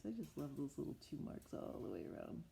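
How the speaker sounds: usual speaking voice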